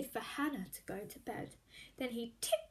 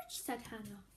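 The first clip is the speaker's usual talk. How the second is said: whispered aside; 4 semitones lower, 9 dB quieter than usual. A narrator's reading. Narration whispered.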